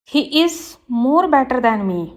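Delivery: slow, careful read of the wrong sentence; slow teacher dictation. Shown as a demonstration. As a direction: spell it out word by word for the class. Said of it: "wrong है".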